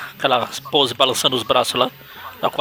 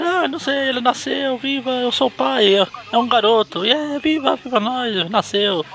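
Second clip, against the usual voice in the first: much higher, giddy new dad; high excited voice; 11 semitones higher than usual. Acted. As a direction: squeal it like a flustered dad. [name] voiced, far higher.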